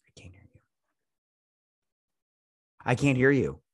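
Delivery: ordinary delivery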